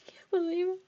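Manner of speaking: high mock-sobbing whisper of a vain crier; mocking impression of Amber. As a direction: coo it in a fake teary whisper, mocking her. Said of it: "You're going".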